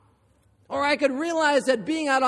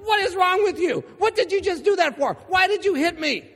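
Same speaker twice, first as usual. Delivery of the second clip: higher and louder, retold indignant protest; indignant outburst; 7 semitones higher, 3 dB louder than usual. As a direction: fire back at the kid who hit you. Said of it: "and I'm like".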